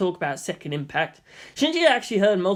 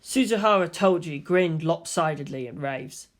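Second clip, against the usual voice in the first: usual speaking voice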